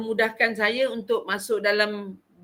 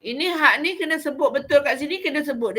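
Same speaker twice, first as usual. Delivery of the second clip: normal talking voice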